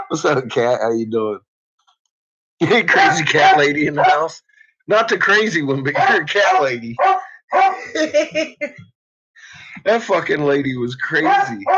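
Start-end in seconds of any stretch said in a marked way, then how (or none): none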